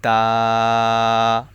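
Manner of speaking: তা with tongue tip at the upper tooth roots, drawn out; tongue tip to the gum, say তা; ta demo. Shown as a demonstration. Teacher modelling the letter, held demo. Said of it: "জিহ্বার আগা সামনের উপরের".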